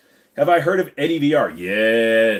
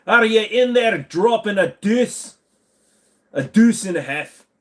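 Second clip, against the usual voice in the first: mock Scottish accent; 9 semitones higher, 5 dB louder than usual. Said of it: "trying to do a Scottish accent".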